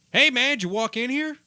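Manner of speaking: yelled across the store, high and loud; shouted call. Retold or imitated. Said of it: "and I hear".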